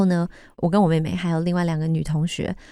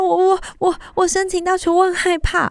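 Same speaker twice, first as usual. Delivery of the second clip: high, urgent pleading voice; a scared kid begging for help; panicked plea to mom; 12 semitones higher than usual. Retold or imitated. Calling her mom long-distance, high and panicked.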